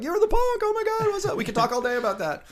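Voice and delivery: very high, loud fan squeal; excited writers gushing over the punk at a Disney meeting. Retold or imitated. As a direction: gush like starstruck fans.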